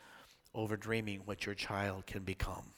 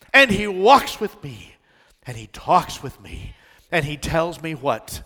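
call to congregation; 4 semitones higher, 15 dB louder than usual. Acted. Preacher calling out to the congregation to answer, loud sing-song. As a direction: lead the crowd to answer.

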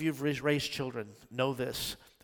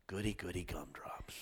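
delighted aside; 6 semitones lower, 8 dB quieter than usual. Real, not acted. Delighted aside after verse.

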